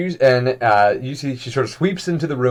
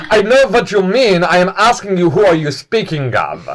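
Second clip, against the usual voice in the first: higher, louder, sharp impatient demand; impatient lady; 6 semitones higher, 4 dB louder than usual. Acted. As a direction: press him hard for an answer. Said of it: "And she goes".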